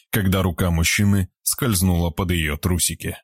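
ordinary delivery, nothing marked

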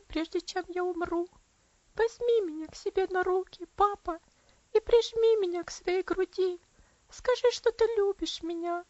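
high, pleading little voice; pleading child. A narrator's reading. Dying girl pleading to her absent father, high and imploring. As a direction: beg for one last kiss. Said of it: "Трогательный, умоляющий голосок".

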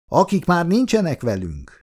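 high-pitched answering toast, pitch dropping to finish; echoing the toast. A narrator's reading. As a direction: repeat the toast with NINCSENEK lifted high. The others echo the second toast.

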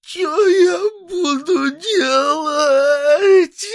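очень высоко, очень медленно, с плачем; провой с рыданием; воет сквозь слёзы. Acted. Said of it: "а мальчик выл". (мальчик воет в голос, плача)

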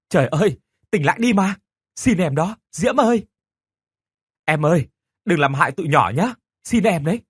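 desperate pleading. Acted. Teacher begs ghost.

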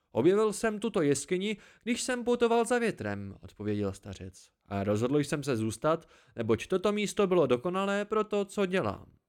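a lively hermit delighted to see company; the old listener welcoming a weary traveller; cheerful old hermit. A narrator's reading. Old man explains himself.

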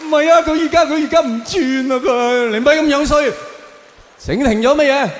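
high-pitched flustered excuse to the cop; flustered protest. Acted. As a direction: plead in a rush, stumbling over words. Panicked man defends himself.

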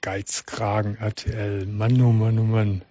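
low, soft muttering to himself; muttered grumbling. Real, not acted. Host to himself after the call, muttered grumbling.

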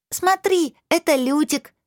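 put-on high, bright little girl exclaiming; a child showing off her kitten with delight; joyful exclamation. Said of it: "радостно воскликнула она".

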